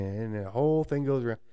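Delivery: normal talking voice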